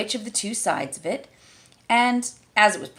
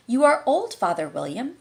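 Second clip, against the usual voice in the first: dutiful schoolgirl recitation, higher; Alice reciting; 5 semitones higher, 3 dB louder than usual. A narrator's reading. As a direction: recite it like a lesson. Alice folded her hands and began.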